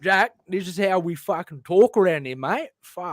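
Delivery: laying on the Aussie larrikin; exaggerated Australian bloke; Australian accent. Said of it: "full tilt that impression".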